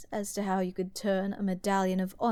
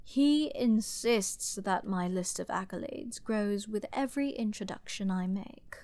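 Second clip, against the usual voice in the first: normal talking voice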